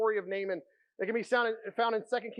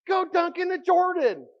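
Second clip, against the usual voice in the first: much higher and louder, pushing hard on "Go dunk in the Jordan"; the servant pleading, almost shouting, to just do it; exasperated urging; 10 semitones higher, 7 dB louder than usual. Retold or imitated. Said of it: "this is me paraphrasing".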